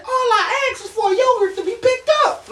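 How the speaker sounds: very high-pitched indignant plea; hammed-up wronged complaint; exasperated protest. Storyteller protesting that he only wanted a yogurt, exasperated.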